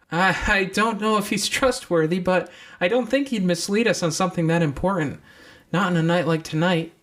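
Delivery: put-on high young girl's voice; deer girl's voice. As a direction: pitch up into the deer girl. Deer speaks, relieved.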